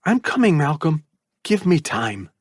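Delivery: calm and comforting; mother's voice. A narrator's reading. Mother reassures her trapped son, lightly voiced.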